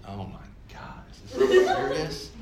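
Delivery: breathless laughing whisper of disbelief. Retold or imitated. Recalling spotting the famous author at church, laughing in disbelief. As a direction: gasp it out through a laugh, can't believe it. Stunned he's at the service.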